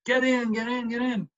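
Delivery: high-pitched imitation of pleading fans; beseeching crowd. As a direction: urge the ball in like a begging spectator. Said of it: "you know, beseeching".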